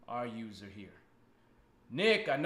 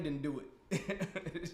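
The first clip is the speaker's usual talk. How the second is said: laughing drawl; 6 dB quieter, much slower than usual. Real, not acted.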